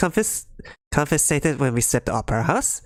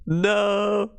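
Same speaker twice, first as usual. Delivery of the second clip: panicked cry; 8 semitones higher than usual. Acted. Explosion about to hit.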